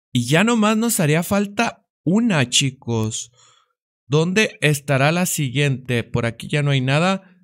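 ordinary talk, nothing marked

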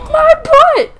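extremely high, loud shriek; squealed panicked yelp; frantic mock distress. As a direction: shriek it at the top of the range. Repeated cry, higher.

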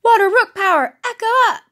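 a second, bolder go at the pretend transformation; heroic power-up call. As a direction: shout it out to transform.

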